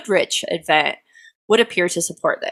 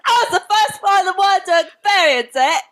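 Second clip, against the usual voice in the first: high, hammed-up British yelling; mock a British accent as a high yell; 11 semitones higher than usual. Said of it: "That's kind of all they do is yell".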